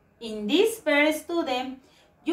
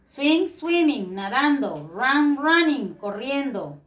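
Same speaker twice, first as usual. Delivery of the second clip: slow modelling. Shown as a demonstration. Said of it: "la I N G".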